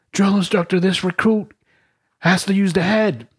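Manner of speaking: high, timid recruit voice; recruit asking. Retold or imitated. Recruit asking the drill instructor for the head, high and meek. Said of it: "Lily on the top rack says".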